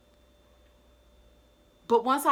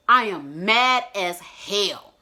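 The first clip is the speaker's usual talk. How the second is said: showing her anger; 8 dB louder, much slower than usual. Shown as a demonstration. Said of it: "identifying that I'm angry right now".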